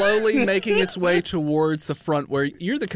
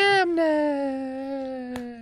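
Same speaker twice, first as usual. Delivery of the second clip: slow wailing train voice; 8 semitones higher, much slower than usual. Pretend. Train voice drawn out.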